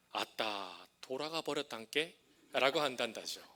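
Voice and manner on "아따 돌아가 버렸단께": lightly voiced Jeolla dialect, a bit slow; mock Jeolla drawl, understated. Pastor imitating Jeolla folk on a death, light Jeolla dialect. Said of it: "이 전라도 분들은".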